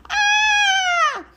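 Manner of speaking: high piercing cry of alarm; shout. Acted. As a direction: let out a sudden scared cry. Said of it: "hears a shout".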